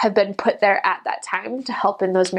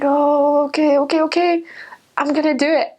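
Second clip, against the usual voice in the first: high, jittery rush of okays; nervous giving in; 6 semitones higher than usual. Retold or imitated. Missionary nervously agreeing to dump the alcohol. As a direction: cave in nervously.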